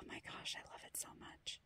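barely audible, tender whisper; quietly in love with the pen; faint whisper. Real, not acted. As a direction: breathe it out softly. Very soft whisper, emotional.

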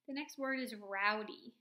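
usual speaking voice

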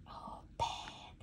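barely a whisper. Real, not acted. Mother whispering to her toddler as the door opens. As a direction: whisper it open gently.

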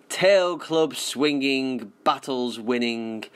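usual speaking voice

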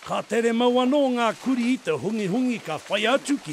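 lively voice-over over music; documentary narration. Acted. Presenter narrating the dogs chasing the pig, lively voice-over. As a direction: tell the chase like a nature documentary.

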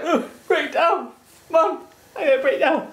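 high, slow whiny leek voice; leeks voicing. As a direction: give the leeks a dramatic wail.

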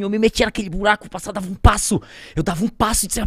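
ordinary delivery

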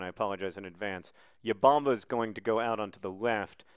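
normal talking voice

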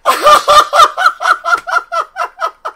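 very high, long cackle; hysterical laughter. Real, not acted. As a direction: laugh helplessly till out of air. Laughs at the fake account.

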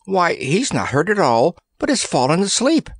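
high-pitched, wondering surprise; amazed disbelief. A narrator's reading. Randy sees the fallen headsman sleeping, amazed. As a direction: marvel at it in a high voice. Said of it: "hardly able to believe his luck".

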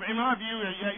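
ordinary talk, nothing marked

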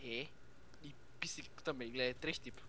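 whispered aside; whispering. Real, not acted. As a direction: whisper it under your breath. Sussurro no meio da conversa.

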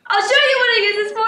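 loud, fast threat with the knife; menacing threat. Retold or imitated. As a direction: bark it at her, fast and menacing. Father threatens mother with knife.

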